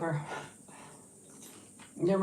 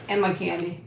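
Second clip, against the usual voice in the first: ordinary delivery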